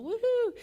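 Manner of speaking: high-pitched woohoo; woohoo cheer. Real, not acted. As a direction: whoop high for your own crowd. Cheering her speaking people.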